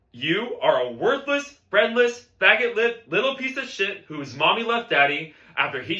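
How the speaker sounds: enraged bandleader's abuse; vicious tirade. Acted. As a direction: hurl each insult at the student. Teacher insulting drummer.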